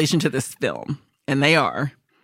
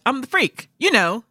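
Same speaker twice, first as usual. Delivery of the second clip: smug hacker; 9 semitones higher than usual. Retold or imitated. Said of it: "He's like".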